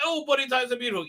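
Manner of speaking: high, quick mock-Irish voice; hammed-up Irish lilt; fake Irish accent. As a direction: rattle it off in silly Irish. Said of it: "Let me do a fake Irish accent".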